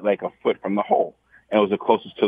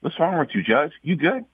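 higher, mock worried check-in; imitating himself asking a shaken friend; retold worried question; concerned teammate; 8 semitones higher than usual. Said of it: "I said".

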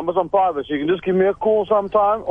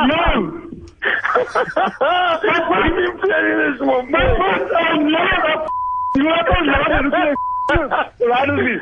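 laughing; 8 semitones higher, slower than usual. Real, not acted. Laughing after the reveal.

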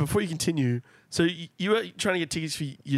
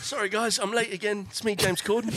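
put-on British accent; apologetic latecomer with a mock British accent; James Corden; 3 semitones higher, 3 dB quieter than usual. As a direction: bustle in, apologizing in British English. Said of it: "When I did the British accent".

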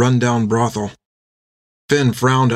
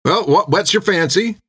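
impatient barman, pitched higher; 7 semitones higher than usual. A narrator's reading. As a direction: press a dithering customer for an order. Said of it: "he said impatiently".

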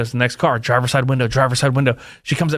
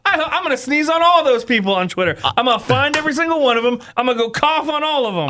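laughing mock threat; 9 semitones higher than usual. Joking revenge on tweeters.

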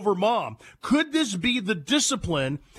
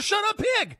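put-on high, bratty child's snap; higher bratty five-year-old telling mom off; bratty kid yelling; 11 semitones higher than usual. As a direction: mimic a rude little boy, higher. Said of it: "He told her".

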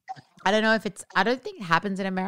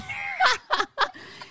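high burst of laughter; shrieking laugh. Real, not acted. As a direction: squeal with laughter. Laughing at the story.

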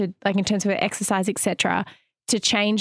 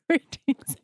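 alien voice; 5 semitones higher than usual. Pretend. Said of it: "the word greetings makes me think of aliens".

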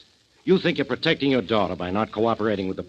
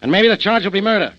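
raised, louder voice, driving the charge home; stern threat; 7 semitones higher, 5 dB louder than usual. Acted. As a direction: hammer out the accusation. Inspector threatens suspect with arrest.

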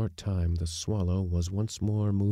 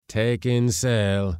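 slow, loud curt order; shortly; 5 semitones higher, 6 dB louder, much slower than usual. A narrator's reading. Skipper orders the mate to take in sail, curt and loud. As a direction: bark the order and nothing more. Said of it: "said the skipper shortly".

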